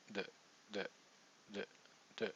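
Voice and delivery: D said as a soft flapped D; soft D drill. Shown as a demonstration. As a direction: tap the D lightly, almost an N. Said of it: "you're gonna say".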